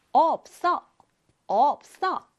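없어 read clearly as eop-seo; pronunciation demo. Shown as a demonstration. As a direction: sound out 없어 slowly for the class. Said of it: "이렇게 읽습니다".

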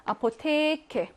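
아포테케 with 테 held long; long vowel. Shown as a demonstration. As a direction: draw out the e of 테 in 아포테케. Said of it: "긴 애는 어떻게 쓰냐면 에".

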